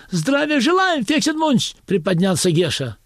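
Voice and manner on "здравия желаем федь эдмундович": high, stiff salute greeting; stiff soldier's greeting. Acted. Convict actor greeting Dzerzhinsky, high and stiff. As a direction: bark it up like an awkward private.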